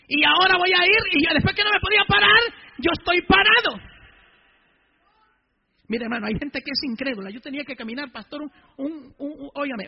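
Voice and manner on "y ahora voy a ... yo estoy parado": loud, high-pitched testimony; fervent preaching. Real, not acted. Preacher testifying he can stand, fervent loud preaching. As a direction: declare the miracle to the congregation.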